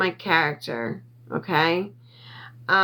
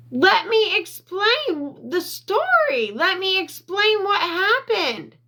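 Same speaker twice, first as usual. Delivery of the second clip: retold screaming plea; 10 semitones higher than usual. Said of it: "I'm sorry for screaming".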